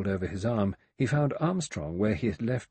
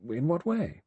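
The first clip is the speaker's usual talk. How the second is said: higher, doubtful question; 7 semitones higher than usual. A narrator's reading. Armstrong questioning Blore's view of Lombard, doubtfully. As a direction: ask it unconvinced. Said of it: "Armstrong said doubtfully".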